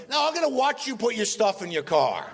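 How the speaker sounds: high mock insistence; Mel Brooks. Mel refusing to leave the guest yet, hammed-up insistence. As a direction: imitate stubborn escorting, pitched well up. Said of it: "And he goes".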